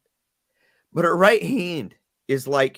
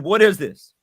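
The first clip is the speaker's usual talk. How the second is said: louder, higher, stern; angry demand; 5 semitones higher, 7 dB louder than usual. Retold or imitated. Dad demanding his daughter explain the letter, angry and loud. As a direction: hammer each word.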